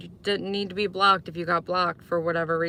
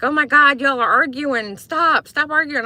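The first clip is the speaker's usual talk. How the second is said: raised, high mock plea to stop; hammed-up shout at the arguing pair; mock scolding; 6 semitones higher, 6 dB louder than usual. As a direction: play the annoyed viewer telling them to stop. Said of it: "some people are like".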